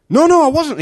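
high, loud, desperate; desperate denial. Acted. Peter denies third time.

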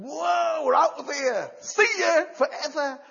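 high, loud whooping cry of joy; excited shout. Acted. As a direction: shout it like freed people running out. Israelites shouting as they leave.

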